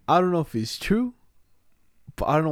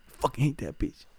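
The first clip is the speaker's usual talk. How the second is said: hushed hate; 5 dB quieter than usual. Real, not acted. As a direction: mutter the hate almost voicelessly. Hatred of the teacher, muttered.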